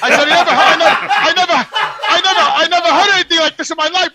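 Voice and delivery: replaying how hard he pushed back on the caller; retold outburst. Retelling his outburst at the caller.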